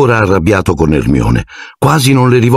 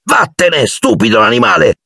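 angry yell; 11 semitones higher than usual. A narrator's reading. Ron to the cat clawing his bag, angry yell. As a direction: drive the animal off in a temper.

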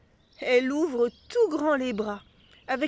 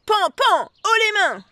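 loud, higher bang-bang cowboy call; playful stick-up; 9 semitones higher, 7 dB louder than usual. A narrator's reading. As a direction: play robber at the door. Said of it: "une voix dit". (cow-boy qui crie pour jouer)

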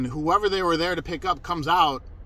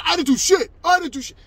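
high, yelled denial in imitation; loud, pitched-up belligerent impersonation; loud as fuck; 13 semitones higher, 7 dB louder than usual. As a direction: mimic him shouting defensively. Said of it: "Just loud as fuck".

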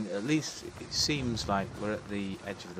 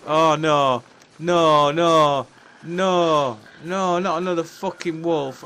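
loud, high panic at a wolf; panicked yelling; 7 semitones higher, 11 dB louder than usual. Real, not acted. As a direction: scream in terror at the attacking wolf.

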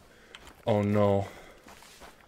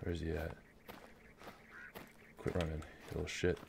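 much softer, low and hushed; hushed hunter; 5 semitones lower, 18 dB quieter, faster than usual. Real, not acted. Hunter stalking his target, muttering under his breath. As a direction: keep it down so the prey won't hear. Stalking prey, keeping quiet.

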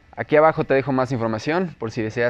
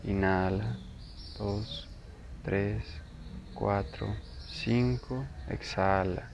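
slow, softer, lower meditation voice with long pauses; guided meditation; 3 semitones lower, 11 dB quieter, slower than usual. Acted. Teacher leading listeners into breath awareness, guided meditation. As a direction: guide the listener unhurriedly through breath and body.

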